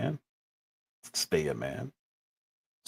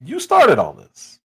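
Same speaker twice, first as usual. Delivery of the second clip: scolding; 5 semitones higher, 6 dB louder, slower than usual. Retold or imitated. Scolding Kai in retelling.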